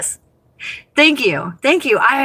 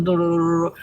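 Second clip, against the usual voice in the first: monotone mock; 7 semitones lower, 4 dB quieter, faster than usual. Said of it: "they're very just monotone and they talk like this".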